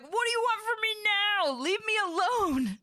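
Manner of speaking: very high, whiny grumbling voice; grumpy colonist. Retold or imitated. Streamer voicing a colonist woken at night, high whiny grumble. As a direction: whine like a woken-up sleeper. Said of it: "Osiris is like".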